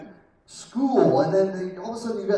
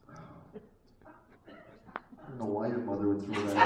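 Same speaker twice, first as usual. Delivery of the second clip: sheepish cover-up; 9 semitones lower, 5 dB quieter, faster than usual. Pretend. Dad covering, quick aside to daughter.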